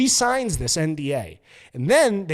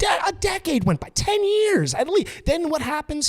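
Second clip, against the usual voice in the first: excited disbelief; 12 semitones higher than usual. Real, not acted. Incredulous storytelling rant.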